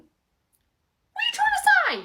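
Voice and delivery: very high, loud, drawn-out indignation; loud high-pitched affronted demand; offended outburst. Acted. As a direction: screech it, slowly, in offence. Offended at softly-spoken remark.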